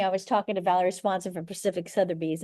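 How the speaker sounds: ordinary delivery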